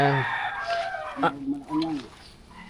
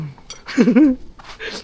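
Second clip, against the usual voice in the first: laugh. Real, not acted. Laugh after tasting.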